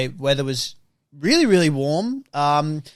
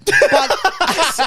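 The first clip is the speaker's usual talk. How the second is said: normal talking voice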